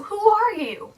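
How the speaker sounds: high, frightened cry out. Acted. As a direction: cry out in alarm. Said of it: "and he cried out".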